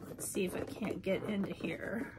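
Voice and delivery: whispered to herself; whispering. Real, not acted. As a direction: whisper it while fiddling with the box. Muttering while opening the box.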